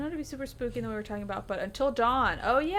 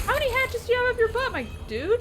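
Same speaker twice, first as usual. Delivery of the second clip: exasperated yell; 13 semitones higher, 5 dB louder than usual. Real, not acted. Hit by thrown hatchets.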